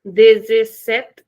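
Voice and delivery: dezessete slow, final e dropped; slow European dezessete; European Portuguese. Shown as a demonstration. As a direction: clip the last vowel, European way. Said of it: "In European, we'll say dezessete".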